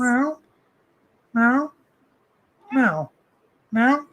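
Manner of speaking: playful meow at the cat; mock cat meow. Meowing to the cat.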